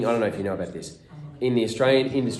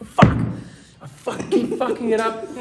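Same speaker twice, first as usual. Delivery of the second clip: loud, high mock swearing fit; imitated angry outburst; 13 semitones higher, 5 dB louder than usual. Actor cursing at himself over a fluffed line, loud and high. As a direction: swear at yourself, higher and louder. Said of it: "and he'd go".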